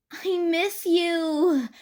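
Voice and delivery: high, very slow, swooning whine; dramatic swoon. A narrator's reading. Mizuki swooning at An, dramatic and drawn-out. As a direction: melt into it theatrically. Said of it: "Mizuki swoons dramatically".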